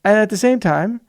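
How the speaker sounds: normal talking voice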